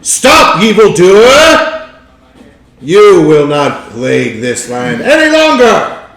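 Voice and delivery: loud, drawn-out heroic shout; over-the-top crusader's battle cry; booming challenger. Acted. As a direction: bellow a grand challenge, pitched high and slow. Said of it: "deep voice".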